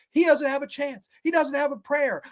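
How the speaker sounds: a touch of promo swagger; wrestler promo boast. Acted. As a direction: taunt him like a heel wrestler. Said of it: "cut a promo".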